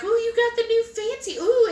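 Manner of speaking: high, cooing imitation of impressed staff; mock awe. Nurses admiring the patient's new bed, exaggerated mock awe. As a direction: coo it high, as if marveling.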